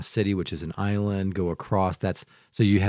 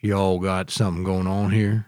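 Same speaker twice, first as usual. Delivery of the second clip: put-on Southern accent. Southern accent, showing off for friends on the podcast. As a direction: lean into a Tennessee Southern accent. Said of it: "Tennessee or Southern accent".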